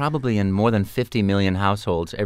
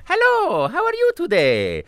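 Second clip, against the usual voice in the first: high cheerful cartoon greeting; bright friendly plumber; Mario voice. Shown as a demonstration. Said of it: "so I can go".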